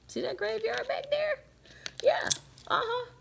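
squeaky high aside; high playful voice. Real, not acted. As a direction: pop up into a high teasing voice. Playful aside about graveyard.

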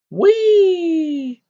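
very high, loud, drawn-out squeal; gleeful "whee". A narrator's reading. Poltergeist lobbing water bombs, gleeful high squeal. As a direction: squeal with mischievous glee. Peeves lobs a water bomb.

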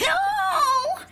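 high, drawn-out cry of dismay; wailed no. Acted. Loser crying out as the game ends, dismayed. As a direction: wail it out high and long. Loses the final round.

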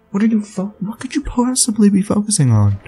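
high, baffled complaint; exasperated. Real, not acted. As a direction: whine at the camera in disbelief.

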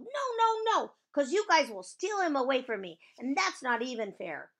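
high, loud protest; high-pitched, outraged complaint; indignant yelling. Acted. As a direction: yell it like you're being robbed. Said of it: "she yelled".

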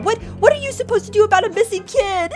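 high-pitched indignant demand; Karen outburst. Acted. Big sister confronting the officer, acting the indignant Karen. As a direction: demand it like an outraged complainer. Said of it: "I'm about to Karen out".

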